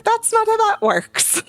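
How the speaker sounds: very high, drawn-out protest; exasperated squeal. Real, not acted. Self-taught reader protesting about pronunciation, exasperated high squeal. Ranting about learning words by reading.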